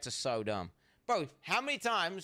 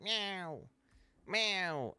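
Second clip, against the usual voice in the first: higher mock meow, a bit playful; imitating a cat meow; 6 semitones higher than usual. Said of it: "And they'll be like".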